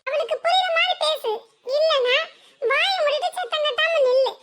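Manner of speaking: high-pitched, half-laughing scolding of a sister; scolding elder sister. Acted.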